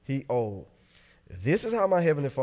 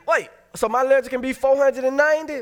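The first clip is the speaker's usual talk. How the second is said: much higher, louder disbelieving squeak; shocked Peter; 12 semitones higher, 8 dB louder than usual. Retold or imitated. Peter objecting to Jesus about forgiving four hundred ninety times, much higher and louder. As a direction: sputter in disbelief at the huge number.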